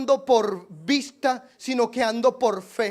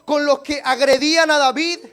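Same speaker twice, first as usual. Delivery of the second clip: full-force preaching; 5 semitones higher, 8 dB louder than usual. Acted. Pastor to his congregation, crying out God's fury loudly. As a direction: thunder out God's anger over David, building.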